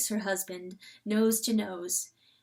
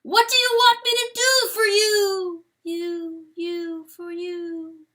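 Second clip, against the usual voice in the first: high, loud ringing echo, fading at the edges; a shout bouncing back through darkness; 8 semitones higher, 6 dB louder than usual. A narrator's reading. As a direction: let it echo back from far away. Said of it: "And an echo".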